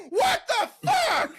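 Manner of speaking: high, loud, drawn-out yell; retold outraged shout. Yelling at drive-thru from back seat, high full-volume outrage. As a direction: bellow it in mock outrage. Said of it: "I was like".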